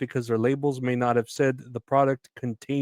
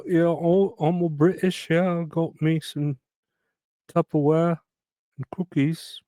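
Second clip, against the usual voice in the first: put-on a light Cockney chimney sweep accent, pitch raised; British chimney sweeper accent; 5 semitones higher than usual. Vlogger doing his chimney sweep accent for viewers, light mock Cockney. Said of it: "That's my British chimney sweeper accent".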